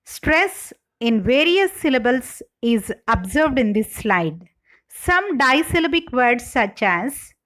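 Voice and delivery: ordinary talk, nothing marked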